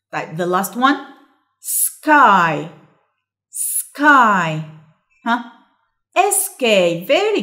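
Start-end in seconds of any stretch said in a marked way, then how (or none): 1.6-4.5 "Sky" with held s and long falling vowel; dictation word. Shown as a demonstration. Teacher dictating for listen and complete, emphatic drawn-out word. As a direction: sound out "Sky", stretched and firm. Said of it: "هنسمع ونكمل".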